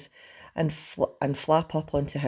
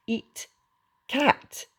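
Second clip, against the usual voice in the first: broken-off words, last one jumping high; dragon menace; 11 semitones higher than usual. A narrator's reading. Toothless decides to eat the cat, creature voice. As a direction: say it as a creature eyeing prey.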